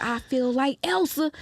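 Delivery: imitating friend, pitched high; mock dazed wonder. Friend calling her while high on shrooms, mock wonder.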